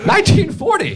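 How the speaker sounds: stunned high repeat of Nineteen forty; mock shocked emphasis. Shown as a demonstration. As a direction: hammer Nineteen forty like it's outrageous. Repeats year, mock outrage.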